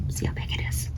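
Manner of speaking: hushed unvoiced whisper. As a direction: breathe it to the children. Reader whispers about Pluto's heart.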